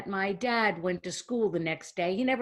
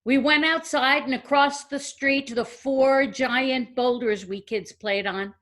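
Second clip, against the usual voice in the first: usual speaking voice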